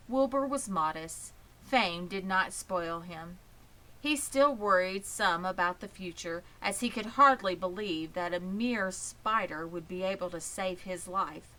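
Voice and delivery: ordinary delivery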